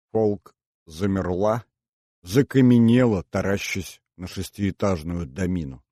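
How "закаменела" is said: ordinary delivery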